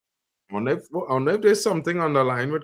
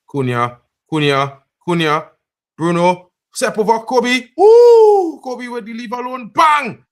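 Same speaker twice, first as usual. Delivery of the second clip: building from raised calls to high, loud excitement; excited play-by-play; 10 semitones higher, 10 dB louder than usual. Real, not acted. Watcher calling the skill move live, excited. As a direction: call the attack louder and higher, up to a shout.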